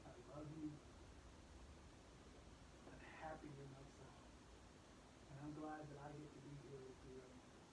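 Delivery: hushed father's comfort at the bedside; tender hush. Retold or imitated. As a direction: soothe a frightened child to sleep. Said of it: "I just kinda whispered in his ear".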